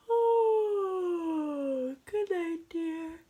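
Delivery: real yawn running into the words. Real, not acted. Zookeeper's wife to her husband at bedtime, yawning goodnight. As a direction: start high and loud in a yawn, talk through it. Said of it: "that was a real yawn".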